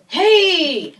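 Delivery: loud, high, drawn-out hail; exuberant greeting. Retold or imitated. Director greeting her on the phone, loud and exuberant. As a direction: imitate a big hollered hello.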